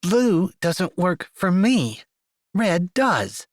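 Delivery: forced out through clenched teeth; gritted teeth. Acted. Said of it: "through gritted teeth".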